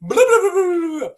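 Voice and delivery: loud, high-pitched run of blahs; high mocking gibberish, all run together; blathering politicians. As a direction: babble it like mock political bluster. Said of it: "politicians and all this stuff, doing all this".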